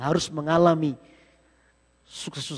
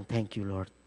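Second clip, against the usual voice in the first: inner thanks; 11 semitones lower, 10 dB quieter, much slower than usual. Retold or imitated. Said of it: "Saya dalam hati bilang".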